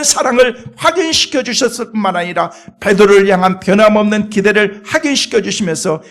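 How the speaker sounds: loud, ringing sermon delivery; fervent pulpit preaching; full-force preaching. Acted. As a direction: preach it out to the whole church.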